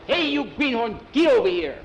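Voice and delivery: high, loud bossy call; acted bossy holler; mock yell. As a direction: yell it like an upperclassman ordering a new kid. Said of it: "I yell".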